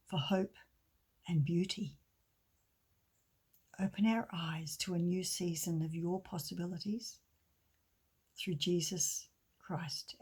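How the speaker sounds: pastor leading a gentle closing prayer. Acted.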